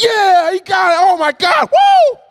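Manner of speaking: high-pitched celebratory shouting; excited cheering. Retold or imitated. Kids celebrating a friend beating the level, excited cheering. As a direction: erupt as if the winning point just landed. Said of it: "we're excited like we've done something".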